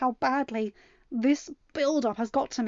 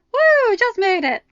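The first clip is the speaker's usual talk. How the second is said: much higher, loud whoop of arrival; excited exclaim; 12 semitones higher, 13 dB louder, slower than usual. Acted. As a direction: burst in cheering that you made it just in time. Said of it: "Carlos exclaimed as he slid in".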